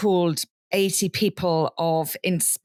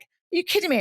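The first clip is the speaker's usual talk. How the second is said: mock disbelief; 9 semitones higher, faster than usual. Retold reaction to spam DMs.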